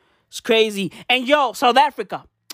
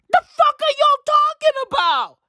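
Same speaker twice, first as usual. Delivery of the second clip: higher, louder yelled disbelief; ranting at fans who gush, even louder; incredulous shout; 4 dB louder than usual. Real, not acted. Repeated louder outburst.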